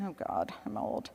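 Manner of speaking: whispered under the breath; muttered aside. Real, not acted. Self-deprecating aside, unvoiced.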